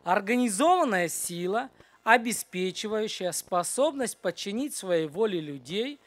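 slow, measured reading, softening at the end; slow dictation. Real, not acted. Teacher reading a definition for boys to repeat, slow dictation. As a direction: read it slowly so they can repeat it.

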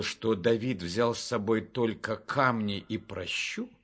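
usual speaking voice

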